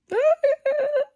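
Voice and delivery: very high, loud imitated wail; hammed-up burst of sobbing; mock crying. Caught crying by a friend, mock wail. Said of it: "my friend caught me crying".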